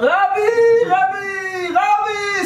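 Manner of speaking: very high shouted calls; comic scream. Retold or imitated. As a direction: shout for the Rebbe in panic, hammed up. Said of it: "Screaming at the Lubavitcher Rebbe".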